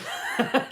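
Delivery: short breathy laugh at himself; real laugh breaking up the rant; burst of laughter. Real, not acted. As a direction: let out a quick laugh. Laughing at his own anger.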